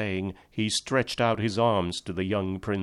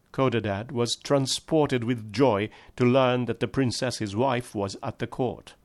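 plain reading voice

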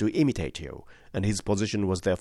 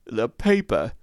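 strained croak, a touch louder; 3 semitones higher, 3 dB louder, slower than usual. A narrator's reading. Revived would-be suicide croaking to the room. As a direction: rasp it out like a half-strangled man. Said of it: "croaked a voice behind us".

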